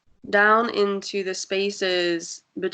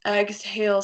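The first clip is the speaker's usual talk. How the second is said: usual speaking voice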